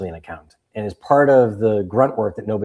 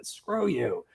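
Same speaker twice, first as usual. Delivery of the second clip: higher, softer Boston brush-off; Boston buddies; 4 semitones higher, 7 dB quieter than usual. Retold or imitated. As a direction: imitate a dismissive Boston pal. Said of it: "my Boston buddies".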